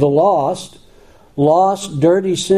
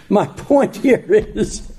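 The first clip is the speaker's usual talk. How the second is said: laughing; 5 semitones higher, slower than usual. Real, not acted. Laughs at his own joke.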